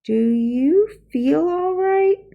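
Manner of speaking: slow and high, worried teacher asking; a slow, high, concerned question; slow, careful question. Acted. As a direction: ask it slowly, with concern. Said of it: "said Missus Plimsoll slowly".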